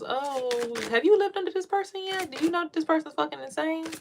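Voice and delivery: high mocking imitation of nosy people; sarcastic impression of judgers. Mock voice of judging people.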